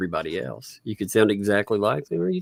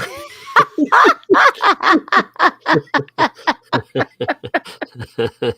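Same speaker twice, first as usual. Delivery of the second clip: long high burst of laughter; big high laugh; 6 dB louder than usual. Real, not acted. Host laughing at her own joke, big high laugh.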